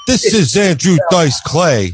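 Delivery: slow, drawn-out comic's phone greeting; Andrew Dice Clay. Retold or imitated. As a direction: imitate a swaggering stand-up announcing himself, slowly. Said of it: "that's just the way he talked".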